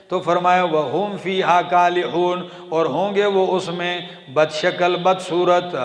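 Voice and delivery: chanted Quran verse, then ardent translation; preaching recitation. Acted. Preacher reciting to congregation, fervent chant.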